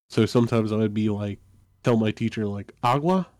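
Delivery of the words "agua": put-on child asking. Child asking teacher for water.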